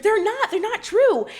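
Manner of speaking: high-pitched mock yell; mildly loud, high mock scream; retold scream. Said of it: "and Lisa screams".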